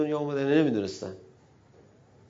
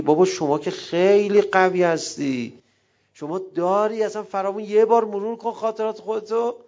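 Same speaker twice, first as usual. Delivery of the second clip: pleading admirer; 6 semitones higher, 5 dB louder than usual. Pretend. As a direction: urge him gently to see his own worth. Pleading with Ali in role. (التماس ملایم و آرام)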